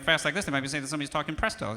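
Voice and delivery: quick, rushed run of words; fast-talk demo. Shown as a demonstration. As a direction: race through it to show presto. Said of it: "talking like this".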